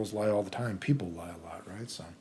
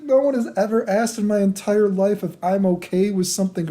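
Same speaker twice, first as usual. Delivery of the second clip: high, put-on emotional voice; higher-pitched mock distress; imitated tearful confession; 8 semitones higher than usual. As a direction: mimic her overwhelmed and tearful.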